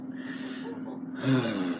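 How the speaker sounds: long, soft, breath-heavy sigh; exasperated sigh. Real, not acted. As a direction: let out a weary breath. Exasperated by a plot decision.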